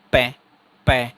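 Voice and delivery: "पै" with a burst of air; sudden-release demo. Shown as a demonstration. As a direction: pop the "पै" out all at once. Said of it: "एकदम से हवा बाहर निकल जाएगी".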